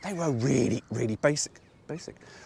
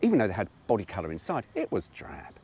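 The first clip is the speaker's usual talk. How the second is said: ordinary talk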